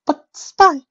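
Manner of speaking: high, pleading; begging him to reconsider; pleading lady voice. Acted. Rarity protesting.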